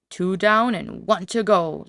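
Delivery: gloating wolf. A narrator's reading. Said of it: "laughed the wolf".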